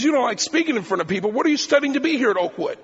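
can't believe a preacher-to-be says that; incredulous. Retold or imitated.

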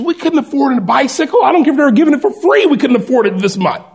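high, fast, fussing complaint; mock-frantic dad griping about money; exasperated father. Father griping to wife about a bike, high and fast.

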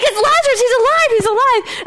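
high-pitched, excited crying out; amazed excitement. Acted. Onlookers seeing Lazarus leave the tomb, wildly excited. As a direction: shout out the astonishing news, voice leaping up. Crowd sees Lazarus alive.

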